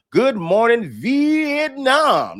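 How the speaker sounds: drawn-out high announcer yell; radio announcer call. Pretend. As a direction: stretch it like a radio DJ. Host announcing guest nickname.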